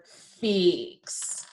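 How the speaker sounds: FIX drawn out with a long vowel; said slowly. Shown as a demonstration. Teacher asking for the first sound, FIX said slowly. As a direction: stretch FIX as a prompt for its sounds. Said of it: "we're going to say it slowly".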